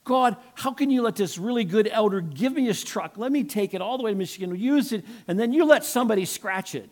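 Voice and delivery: high-pitched indignant complaint; aggrieved, hammed-up rant; mad at God. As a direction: re-enact railing at God in protest. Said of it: "I got mad at God".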